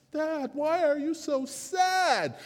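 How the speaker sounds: put-on high, slow child voice asking her dad; a little girl worried for her father; small girl's high questioning voice; daughter's voice. A narrator's reading. Narrator voices young daughter.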